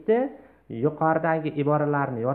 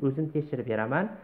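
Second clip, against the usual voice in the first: normal talking voice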